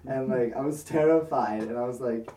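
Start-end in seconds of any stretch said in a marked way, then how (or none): none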